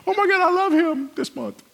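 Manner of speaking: very high, loud squeal of a crush; gushing teenage girl. Acted. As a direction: squeal it like a swooning teenage fan. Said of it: "teenage girl love".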